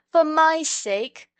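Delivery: high, loud, slow plea; pleading cry. A narrator's reading. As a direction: cry out to wake him. Children pleading over fainted boy.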